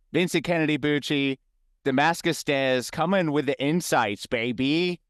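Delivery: hyped podcast outro, voice lifting high; show-host sign-off. Acted. Hosts the show's sign-off.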